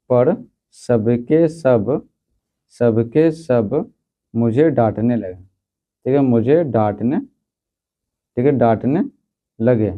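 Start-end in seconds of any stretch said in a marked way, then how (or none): 0.1-3.9 words stretched out slowly for copying; teacher dictating to a class, very slow; slow dictation. Shown as a demonstration. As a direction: dictate slowly so students can write. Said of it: "तो लिखेंगे सोते".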